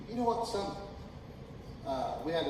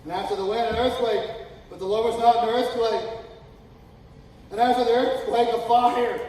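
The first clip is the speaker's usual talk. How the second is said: louder and higher, building to a shout; building preaching; 8 semitones higher, 11 dB louder than usual. Acted. Preacher building up the scripture, rising to loud. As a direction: build the tension, louder each line.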